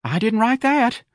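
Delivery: slow, very high piping protest; frightened little clerk, high-pitched; quivering denial. A narrator's reading. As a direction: plead innocence in a high voice. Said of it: "His lips were quivering".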